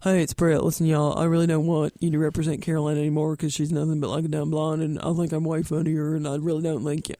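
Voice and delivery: low Southern comedienne voice; prank-call impression in a low drawling register; Brett Butler. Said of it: "I would pretend I was Brett".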